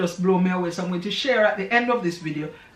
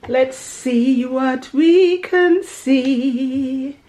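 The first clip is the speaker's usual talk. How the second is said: slow drawl; 5 semitones higher, 8 dB louder, much slower than usual. Real, not acted. Checking the photo.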